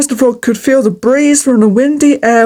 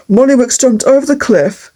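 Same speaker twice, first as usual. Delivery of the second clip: ordinary delivery, nothing marked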